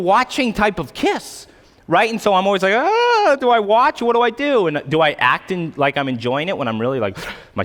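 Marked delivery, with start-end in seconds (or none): 2.7-4.7 high, flustered comic dithering; mock panic. Awkward guest watching a kiss, mock panic. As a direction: squeal it as if caught not knowing where to look.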